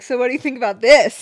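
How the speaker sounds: stirring the pot at full volume; loud provocation. Retold or imitated. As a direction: throw the question out loudly to provoke. Acts herself provoking the family.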